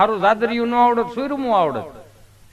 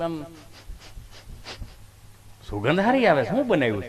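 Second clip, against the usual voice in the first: ordinary talk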